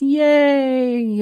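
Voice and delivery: high, bright yay; excited cheer. Real, not acted. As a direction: cheer it out happily. Cheering about new book release.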